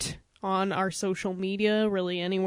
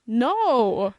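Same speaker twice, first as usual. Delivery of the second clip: put-on French accent. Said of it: "French accent I could do".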